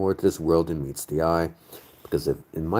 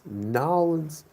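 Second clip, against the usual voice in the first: high, drawn-out Nolans in a Southern drawl; drawn-out Southern twang; 12 semitones higher than usual. Retold or imitated. Northerner savoring the word, high drawn-out Southern drawl. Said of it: "I just love that Southern twang".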